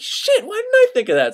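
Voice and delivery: sudden high-pitched realization, hammed-up; a squeaky mock lightbulb moment. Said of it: "And Moses is like".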